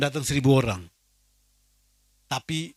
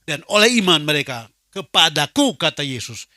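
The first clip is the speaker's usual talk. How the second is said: louder scripture proclamation; fervent preaching; 6 dB louder than usual. Real, not acted. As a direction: preach the verse with conviction. (membacakan ayat dengan khotbah lantang)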